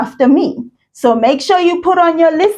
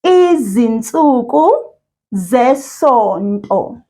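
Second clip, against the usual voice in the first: slow, each syllable drawn out; over enunciating; slower than usual. Shown as a demonstration. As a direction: model Izinsuku zesonto for repeating. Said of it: "I'll say it first and then you repeat after me".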